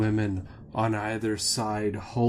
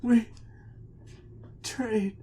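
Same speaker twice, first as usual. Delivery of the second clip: forlorn; 11 semitones higher than usual. Acted. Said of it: "forlorn and dejected".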